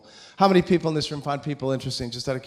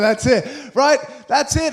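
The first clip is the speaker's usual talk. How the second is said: higher, louder shout of approval; 7 semitones higher, 5 dB louder, slower than usual. Real, not acted. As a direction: yell it to rally the room. Firing up the audience.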